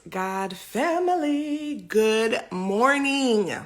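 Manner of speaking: drawn-out upbeat morning hello; cheery greeting. Real, not acted. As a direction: greet the viewers big and slow.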